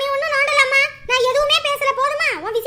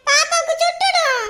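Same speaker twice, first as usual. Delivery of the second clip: put-on child voice; 5 semitones higher, 3 dB louder, slower than usual. Child warns mother about fire.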